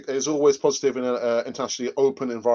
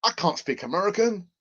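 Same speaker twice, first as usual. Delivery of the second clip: higher-pitched put-on American accent; attempted American accent; 5 semitones higher than usual. Shown as a demonstration. Host trying again for viewers, put-on American accent. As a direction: have a go at sounding American. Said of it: "give us your best American accent".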